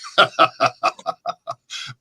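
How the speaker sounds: evil whispery cackle; sneering villain's breathy cackle; mocking emperor laughter; Shao Kahn laugh. Shown as a demonstration. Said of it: "can you do Shao Kahn real quick".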